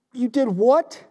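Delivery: stunned reply to bad news; incredulous question. Jesus shocked at disciples.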